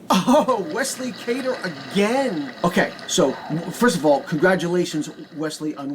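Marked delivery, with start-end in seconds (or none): 0.1-2.5 surprised. Real, not acted. Surprised by repeat winner.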